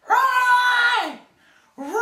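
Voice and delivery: very high, very loud screaming of the name; bloody murder scream. Retold or imitated. Woman behind the apartment window, imitated high terrified scream. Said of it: "It's bloody murder".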